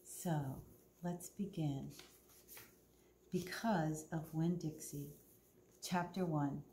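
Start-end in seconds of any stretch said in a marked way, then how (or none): none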